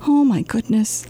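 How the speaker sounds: plain reading voice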